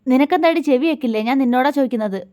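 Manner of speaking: annoyed husband rebuking silent wife; angry. Acted. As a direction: demand an answer angrily. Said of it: "ദേഷ്യത്തോടെ".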